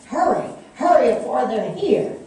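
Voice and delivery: loud, high, frantic urging; desperate shout to hide the children; urgent shouting. A narrator's reading. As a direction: hurry them in, frantic and loud. Mother urging boys into hiding.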